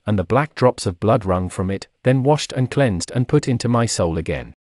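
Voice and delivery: ordinary talk, nothing marked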